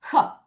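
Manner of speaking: "허" as a short breath of h; H sound. Shown as a demonstration. Teacher showing the first sound of hot, short "허". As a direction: isolate the H as "허". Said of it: "H가".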